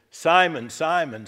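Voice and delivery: loud, high calling of a name; emphatic call. Acted. Jesus calls Simon's name twice with a loud urgent call.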